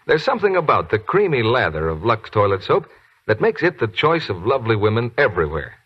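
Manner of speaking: ordinary talk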